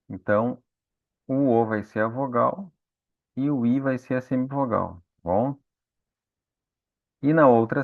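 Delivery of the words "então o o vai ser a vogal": usual speaking voice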